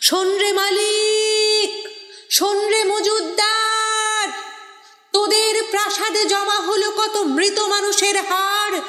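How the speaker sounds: impassioned poetic outcry, held vowels; defiant challenge. Acted. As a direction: cry out an accusation to the oppressors. Poem challenges owners and hoarders.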